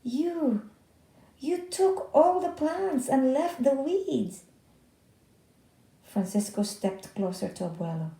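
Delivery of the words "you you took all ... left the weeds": higher, louder dismayed accusation; upset disbelief. Acted. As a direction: let the outrage rise through it. Ben upset at weeding mistake.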